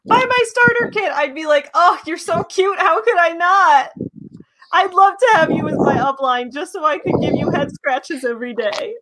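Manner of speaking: high gushing pet voice; talking to a dog. Pretend. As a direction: coo at an imagined puppy. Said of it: "he came up to me and was like".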